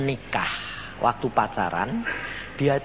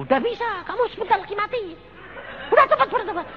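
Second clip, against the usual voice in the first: mock stern warning; faster than usual. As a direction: ham up the urgent warning, much higher. Preacher voices himself to sick man. (nada tinggi pura-pura mendesak)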